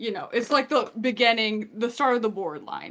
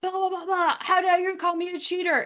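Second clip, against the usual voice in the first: mock cussing out; 6 semitones higher than usual. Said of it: "cussing him out".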